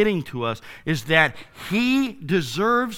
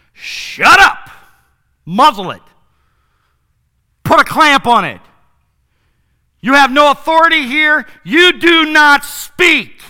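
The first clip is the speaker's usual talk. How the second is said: high, loud commanding shout; furious shout; 7 semitones higher, 13 dB louder than usual. Acted. Preacher as Jesus ordering the demon silent, shouting. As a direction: roar each order at the demon. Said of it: "and Jesus says".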